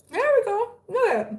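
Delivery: high-pitched coo to a kitten; sing-song, high pet voice; pet talk. Real, not acted. Cooing to a kitten.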